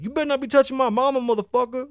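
high, fast mock teen defiance; high-pitched, rushed teenage back-talk; rebellious teenager. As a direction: imitate a mouthy kid standing up to stepdad. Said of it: "you was, like, real rebellious".

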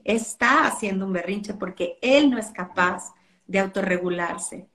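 ordinary talk, nothing marked

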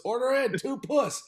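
high, slow expo call; kitchen order callout. Retold or imitated. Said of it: "the expo call for octopus was".

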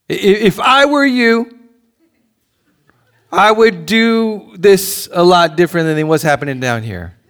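an anguished prayer, voice lifted high; pleading cry to God. Acted. Preacher acts out an anguished prayer.

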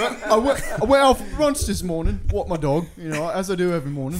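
high, loud British voice with laughs; a posh Brit on his morning walk; mock British accent.

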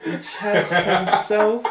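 slow, loud, high laughing "The chest himself"; slow, loud giggling hype. Real, not acted. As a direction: drag it out laughing.

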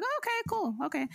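high, forced-polite okay, cool; fake polite okay. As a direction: brush her off with a sugary high okay. Forced polite reply to greeter.

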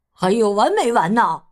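irritated sleeper scolding noisy neighbours; mildly exasperated character voice; annoyed complaint. Acted. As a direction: grumble crossly at the noisemakers. 用棍子敲着房顶.

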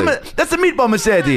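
high cartoon Italian; mock Italian accent. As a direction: ham up a silly Italian accent. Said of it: "Now you're Italian".

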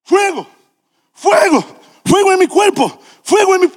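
high excited yelling; shouting. Retold or imitated. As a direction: cry out as if set on fire. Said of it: "y grita".

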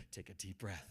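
soft, low calm advice; psychologist advice. Retold or imitated. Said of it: "a psychologist will say".

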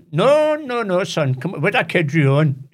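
high, loud, teasing reassurance from a father; playful dad. Retold or imitated. Dad reassures his young son about the zoo, teasing. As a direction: coax the boy along, half laughing.

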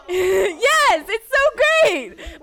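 high, loud, slow laughing joy; laughing delight. Real, not acted. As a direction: burst out laughing with glee.

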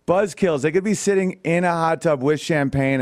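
normal talking voice

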